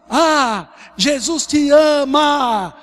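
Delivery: imitação de gritos de rua, alta e arrastada; grito de grupo imitado. Retold or imitated. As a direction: grite alongando as vogais, como um grupo evangelizando na rua. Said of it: "e só gritava assim". (gritando, mais agudo e bem lento)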